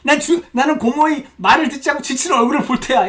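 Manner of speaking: put-on high, loud childish insistence; hammed-up stubborn resolve; mock determination. Preacher parodying niece forcing compassion, mock determination.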